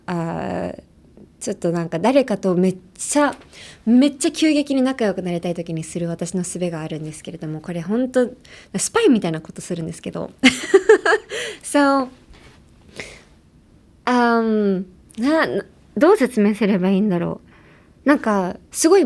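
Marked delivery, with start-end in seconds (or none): none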